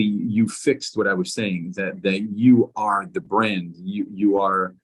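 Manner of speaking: ordinary talk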